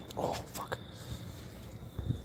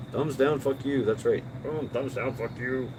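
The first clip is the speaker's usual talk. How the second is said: higher, mock-hype thumbs-down rant; 6 semitones higher than usual. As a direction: cheer on the thumbs down with fake enthusiasm. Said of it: "hit that thumbs down button".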